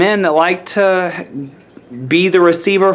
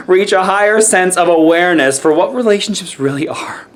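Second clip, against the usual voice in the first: ordinary talk, nothing marked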